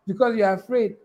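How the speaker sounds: ordinary talk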